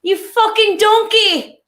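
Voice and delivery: high, loud, drawn-out insult; slow, hammed-up furious yell; mock outraged shout. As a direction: bellow it like a fed-up TV chef. Quoting Gordon Ramsay's catchphrase.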